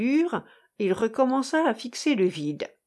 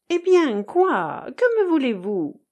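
louder, higher exasperated challenge; brusque impatience; 6 semitones higher, 5 dB louder than usual. A narrator's reading. Irène turns abruptly on the pestering lady, brusquely impatient. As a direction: throw the question back sharply. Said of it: "se retourna brusquement".

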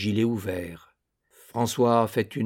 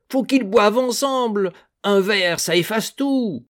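put-on much higher, loud drunken cry; drunken insistence; 10 semitones higher, 5 dB louder than usual. A narrator's reading. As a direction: push the drunk plea harder. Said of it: "tout suant d'alcool". (Piron ivre insiste, plus aigu et plus fort)

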